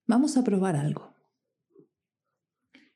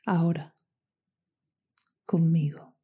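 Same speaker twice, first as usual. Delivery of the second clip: guided meditation. Acted.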